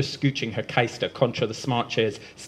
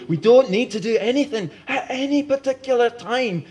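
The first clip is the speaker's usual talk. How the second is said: high, loud hollow echoing line; hollowly; 11 semitones higher, 5 dB louder than usual. Acted. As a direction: say it emptily, far away. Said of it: "says Bell hollowly".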